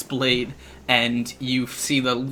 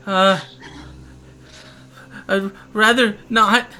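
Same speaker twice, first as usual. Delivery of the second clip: hesitant, high-pitched character voice; reluctant character; 8 semitones higher, 4 dB louder than usual. Acted. Game master voices a character declining to play dead, reluctant. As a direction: lightly voice a higher, reluctant figure. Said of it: "he says".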